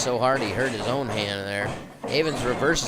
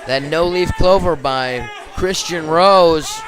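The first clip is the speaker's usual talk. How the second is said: wrestling commentator; 4 semitones higher, 6 dB louder than usual. Real, not acted. Match ending submission call.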